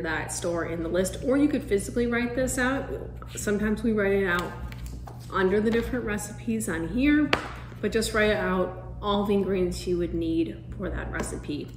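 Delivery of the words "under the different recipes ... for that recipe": ordinary talk, nothing marked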